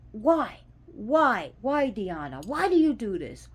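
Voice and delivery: loud, high pleading yell at the gods; yelling at a deity. Retold or imitated. Devotee yelling at the goddess Diana, loud and exasperated. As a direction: shout your frustration up at the heavens. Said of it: "you ever yell at your deities".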